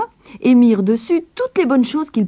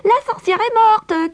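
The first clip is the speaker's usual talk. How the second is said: very high, loud joyful cry; Gretel crying out; 5 dB louder than usual. A narrator's reading. Gretel freeing her brother, high joyful cry. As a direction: shout the victory to her brother. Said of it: "cria-t-elle".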